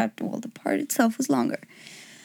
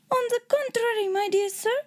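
put-on high, exclaiming German-accented king; raised-pitch German accent; 12 semitones higher than usual. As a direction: protest brightly in a German accent. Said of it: "I hope you liked my German accent".

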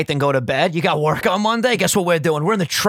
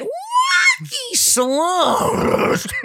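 hyped-up; much slower than usual. Acted. Hyped prediction.

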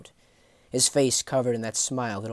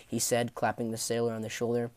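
plain reading voice

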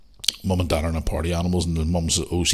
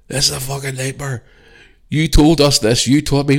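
high, raised-voice angry rant; dad blowing his lid; 8 semitones higher, 7 dB louder than usual. Retold or imitated. Dad ranting at his son about the room, blowing his lid. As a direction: accuse him, outraged and loud. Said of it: "Dad's fucking blowing his lid".

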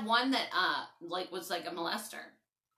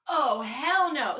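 slow, louder, defiant; hell no; 4 semitones higher, 8 dB louder, much slower than usual. Retold or imitated. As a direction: drag it out in disbelief. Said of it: "I was like".

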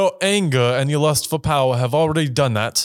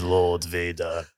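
Palpatine line; 7 semitones lower, 6 dB quieter, slower than usual. Pretend. Acting Palpatine knighting Vader.

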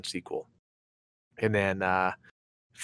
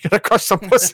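high, laughing dad impression; hyped-up dad voice; 8 semitones higher, 5 dB louder, faster than usual. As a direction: egg him on like a rowdy father. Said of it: "He keeps telling him".

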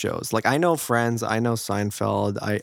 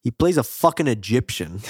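ordinary talk, nothing marked

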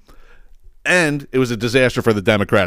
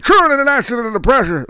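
mock garbled mumble; 13 semitones higher, faster than usual. Mimicking Biden's garbled speech.

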